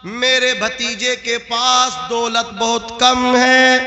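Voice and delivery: high, sustained oratorical chant; slow, ringing proclamation that builds into forceful preaching; declamatory preaching. Acted. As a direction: proclaim it to a crowd, stretching the phrase endings. Preaches Abu Talib's sermon speech.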